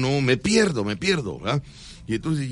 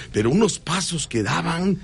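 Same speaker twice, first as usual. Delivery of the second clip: ordinary delivery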